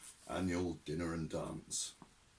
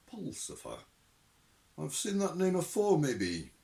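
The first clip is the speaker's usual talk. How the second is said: suspicious Shadwell; 9 semitones higher, 4 dB louder than usual. Acted.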